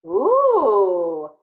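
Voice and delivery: a real impressed ooh; delighted ooh. Real, not acted. Admiring child's picture.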